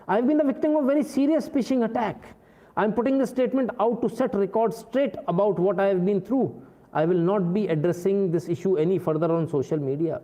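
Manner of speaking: high, slow, put-on English reading; slow high mocking read-out; mock posh statement. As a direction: ham up a prim official statement.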